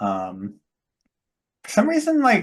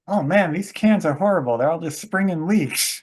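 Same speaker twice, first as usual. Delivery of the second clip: higher-pitched mock complaint about the cans; imitating Steve Martin; 6 semitones higher, 3 dB louder than usual. Retelling the oil-can gag to a co-host, mock exasperated. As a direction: play a hammed-up movie grumble. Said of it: "Steve Martin is stand-, standing there like".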